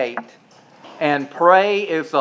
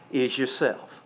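softer, lower hush; dropped to a near hush; 6 semitones lower, 10 dB quieter than usual. Real, not acted. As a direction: let the point land quietly. Preacher drops voice for emphasis.

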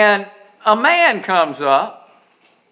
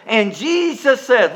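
ordinary talk

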